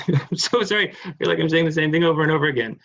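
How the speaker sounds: real laugh through a quick apology. Real, not acted. Lecturer to audience, sheepish about repeating herself, laughing fast. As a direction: laugh it off and rush the words out.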